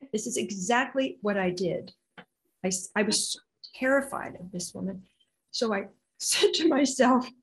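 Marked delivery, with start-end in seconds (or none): none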